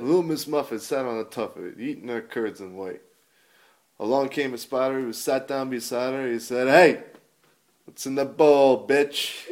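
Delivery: loud, brash nursery rhyme parody; comic nursery rhyme. Retold or imitated. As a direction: recite it like a crude stand-up bit. Reciting a crude comic nursery rhyme.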